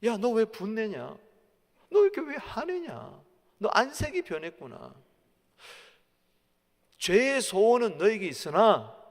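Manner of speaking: lightly acted divine rebuke; God rebuking Cain. God questions angry Cain, a touch of stern rebuke. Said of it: "하나님이 가인에게 그랬어요".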